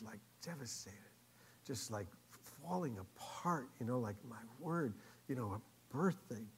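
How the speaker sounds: low, soft, halting; hushed as if overwhelmed; retold devastation. Preacher telling how moved the girl was, hushed. Retells her being overwhelmed.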